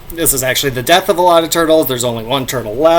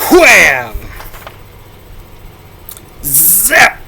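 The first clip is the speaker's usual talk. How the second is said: comic sound effects; 4 semitones higher, much slower than usual. Shown as a demonstration. Vlogger acting out comic sound effects, booming. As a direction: shout each sound effect like the comic panel. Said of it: "dramatic reading".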